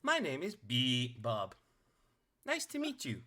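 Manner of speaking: small automaton, syllable by syllable; Bebop. Acted. Robot introducing itself to Neff, high robot voice. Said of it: "Bebop turns a".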